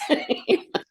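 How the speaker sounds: a bright squeal of laughter; amused high-pitched laugh. Real, not acted. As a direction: burst into a high giggle. Laughing at daughter's reaction.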